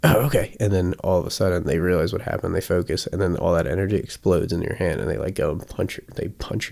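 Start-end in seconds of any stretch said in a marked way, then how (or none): 0.0-0.6 excited dog voice. Retold or imitated. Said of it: "they're like".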